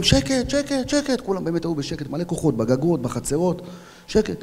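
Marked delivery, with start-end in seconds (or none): 0.0-1.2 high-pitched call for silence; re-enacted shout, pitched way up; retold shout. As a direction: hush a crowd from afar. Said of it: "אז אני צועק לכולם".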